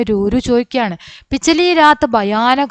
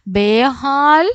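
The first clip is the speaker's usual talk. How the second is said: slow word demo; 3 semitones higher, much slower than usual. Shown as a demonstration. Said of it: "ബേഹാൽ പടേ ഹുയേ ദേ പറഞ്ഞാല്".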